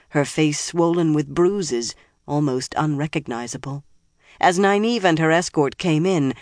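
ordinary delivery

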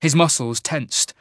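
usual speaking voice

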